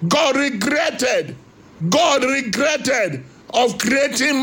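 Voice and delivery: fervent Nigerian sermon voice; urgent pulpit preaching. Acted. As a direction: preach it as a stern warning to the congregation.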